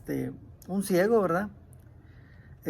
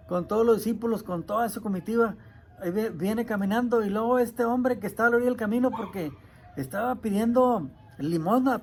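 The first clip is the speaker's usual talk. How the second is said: ordinary talk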